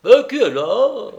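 loud, high, startled question; tipsy shout of alarm; sudden cry. A narrator's reading. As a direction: call out abruptly at a noise. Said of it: "s'écria-t-il tout à coup".

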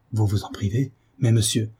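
ordinary delivery, nothing marked

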